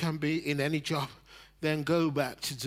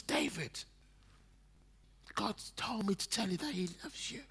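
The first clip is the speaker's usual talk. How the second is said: tender; 4 semitones higher, 8 dB quieter than usual. Retold or imitated. Re-quoting tender message.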